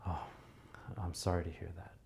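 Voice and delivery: deep breath, heavy soft sympathy; exaggerated sympathy. Shown as a demonstration. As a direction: lay the concern on thick. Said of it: "Much more, like, emphasize it".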